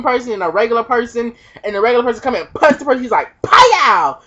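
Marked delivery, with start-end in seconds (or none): 3.4-4.2 cartoon pow. Cartoon punch sound.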